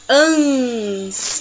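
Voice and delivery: ANS drawn out long and nasal; nasal sound. Shown as a demonstration. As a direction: stretch ANS slowly through the nose. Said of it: "Não dá a impressão que a gente fala pelo nariz".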